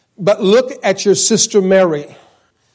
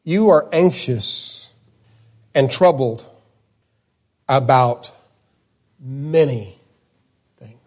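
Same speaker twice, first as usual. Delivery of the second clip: slow and gentle; 4 semitones lower than usual. Retold or imitated. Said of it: "he said".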